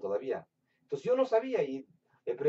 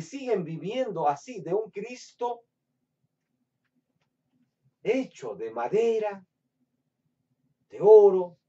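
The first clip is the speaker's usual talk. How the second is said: thundering pulpit sermon; fiery preaching; 6 semitones higher, 5 dB louder than usual. Acted. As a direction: preach it out with fire to the congregation. Preaching at full force.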